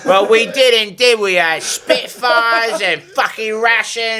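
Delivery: slow ranting pub patriot; English accent rant. Pretend. Joining the bit about British bigots, hammed-up English rant.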